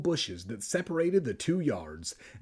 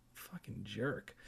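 much softer, slow muttered curse; muttered insult; 4 semitones lower, 14 dB quieter, slower than usual. Real, not acted. Narrator cursing Kermit under his breath. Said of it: "muttered insult aside".

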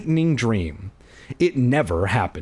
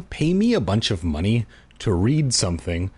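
ordinary delivery, nothing marked

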